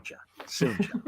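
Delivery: whispered drawn-out tease; whispered singsong taunt; teasing whisper. Retold or imitated. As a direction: breathe it out as a mock taunt. Teasing repeated, now unvoiced.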